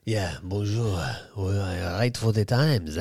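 low, slow mock French lover; badly faked sexy French murmur; silky French voice. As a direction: croon softly in a hammed-up French accent. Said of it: "voice like silk".

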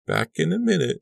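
high, called from another room; calling out. Acted. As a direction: call back over her shoulder. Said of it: "she called".

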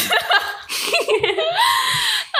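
long high squealing laugh; laughter. Real, not acted. Co-host remembering Mom laughing at her, high laughter. Laughing at complimentary snacks story.

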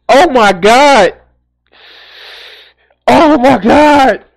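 high, loud, drawn-out exclamation; appalled disbelief. Real, not acted. Streamer appalled by the Karen story, loud disbelief. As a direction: stretch it out slowly, loud and high, aghast.

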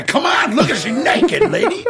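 high laughing protest; mock-indignant shout; exasperated protest. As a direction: throw up your hands, pitch up.

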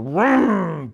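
loud revving vroom; engine vroom. Acted. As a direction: imitate the car engine. Car starting noise. (rugido de motor)

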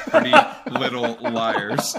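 high, loud, laughing through the title. Real, not acted. Host announcing the show to listeners, laughing. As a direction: break into a laugh mid-title.

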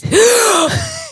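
big, high mock gasp; dramatic intake of breath; shocked gasp. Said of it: "she comes out and she goes".

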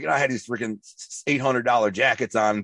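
ordinary talk, nothing marked

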